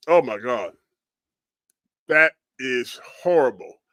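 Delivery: usual speaking voice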